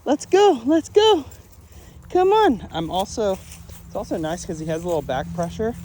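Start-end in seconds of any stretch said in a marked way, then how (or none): 0.0-2.7 much higher and louder, bright urging; high, loud praise for the dog to keep moving; excited dog call. Real, not acted. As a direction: cheer the dog on to follow. Calling dog along.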